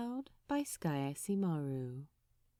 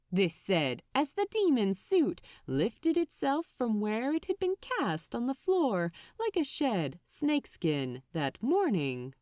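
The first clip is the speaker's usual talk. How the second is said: plain reading voice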